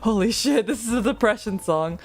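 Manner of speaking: playing the listener who suddenly gets the lyrics; mock shocked realisation. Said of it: "and then you're like".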